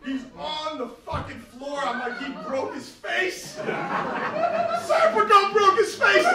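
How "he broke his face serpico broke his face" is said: high, slow, stretched out gasp of disbelief; shocked disbelief. Real, not acted. The waiter seeing the star on the floor, in shocked disbelief. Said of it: "shock at the fall".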